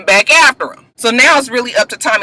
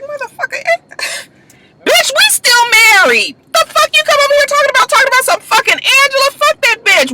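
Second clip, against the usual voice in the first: pitched way up, a furious tirade at her husband; the outraged wife, much higher, tearing into him; furious wife rant; 11 semitones higher than usual. Retold or imitated. Tasha voiced yelling at Ghost.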